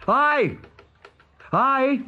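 put-on high boyish call; Peter's own voice. A narrator's reading. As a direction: answer as the boy hero. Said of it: "replied Peter in his own voice".

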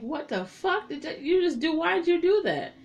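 stunned horror; horrified shock. Retold or imitated. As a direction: recoil in shock.

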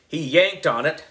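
usual speaking voice

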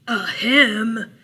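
loud, pointed "ahem"; reminding throat-clear. A narrator's reading. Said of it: "cleared his throat in a reminding sort of way".

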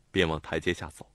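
ordinary delivery

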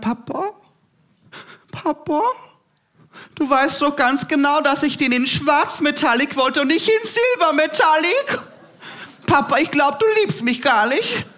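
high, whining mock sulk; pampered girl sulking at her father; whiny spoiled daughter. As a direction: whine at Papa like a spoiled child. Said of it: "Ich hab dann gesagt".

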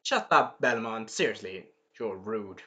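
low, dropping away to a soft, dry rebuke; Alucard. Retold or imitated. Alucard tells Belmont he is rude, low and dismissive. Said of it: "Alucard said".

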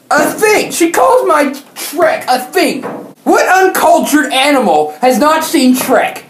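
high, slow, indignant cry; hammed-up outrage over a drawing; mock outrage. Real, not acted. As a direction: act personally insulted, voice climbing high. Outraged at the teacher's word.

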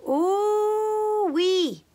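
high delighted squeal. A narrator's reading. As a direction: squeal at the sight of treats. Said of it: "Leola squealed".

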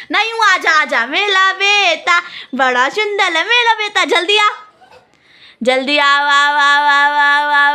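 put-on high, loud sing-song coaxing of a baby; mother calling her little son to come in baby talk; cooing baby talk. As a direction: lisp it like talking to a toddler.

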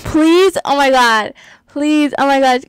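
normal talking voice

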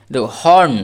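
ordinary talk, nothing marked

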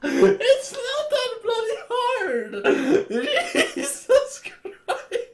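crying with laughter. Real, not acted. Cracking up at the other player's mistake, crying with laughter. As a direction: squeal the words through tearful laughter. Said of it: "I'm crying tears".

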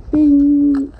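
loud high ding imitating the microwave; microwave bing. Imitating the microwave bing for his dinner, loud and high. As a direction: chime it out like a microwave. Mimicking microwave finish sound.

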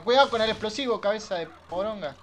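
loud, high-pitched warning shout. Real, not acted. Streamer warns player about the explosive, shouted warning. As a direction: yell a sudden warning at a careless player. Sudden warning during gameplay.

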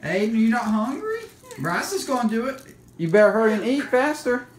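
ordinary delivery

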